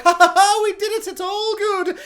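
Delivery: high, hammed-up victory cackle; fake-cheery celebration; mock triumphant laugh. Survivors celebrating, high hammed-up fake laughter. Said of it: "they're like".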